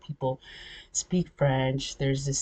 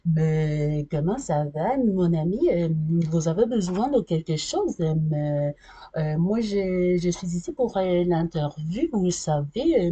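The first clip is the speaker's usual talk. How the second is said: Quebec-style French accent; 2 semitones higher than usual. Shown as a demonstration. Arriving for an interview in Ottawa, thick local French accent. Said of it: "if I come in here like this".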